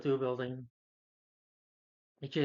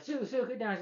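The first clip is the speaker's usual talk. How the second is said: high-pitched imitation of alarm; mock urgent warning; 10 semitones higher than usual. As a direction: call out a hammed-up frantic warning. Mimics urgent warning to Sue.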